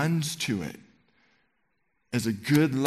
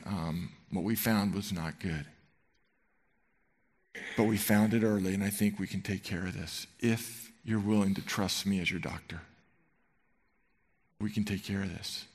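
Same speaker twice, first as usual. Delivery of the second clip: softer, gentle lowered-voice news; compassionate doctor; 4 semitones lower, 7 dB quieter than usual. Retold or imitated. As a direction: break bad news softly, like a caring doctor. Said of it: "with all the compassion and lowered tone and lowered voice".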